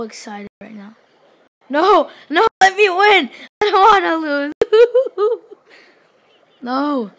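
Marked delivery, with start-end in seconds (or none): 1.7-4.5 loud, high pleading cries; half-laughing loud wail; desperate protest. Real, not acted. Pleading while losing in game. 4.6-5.5 loud, high laugh; high-pitched loud cackle. Real, not acted. Laughs while losing in game.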